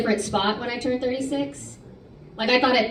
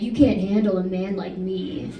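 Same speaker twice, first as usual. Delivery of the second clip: low, slow, soft macho-guy impression; hammed-up young guy bragging slowly; mock macho guy; 6 semitones lower, 6 dB quieter than usual. Young guy hitting on the comic, low and slow mock swagger. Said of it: "the guys will be like".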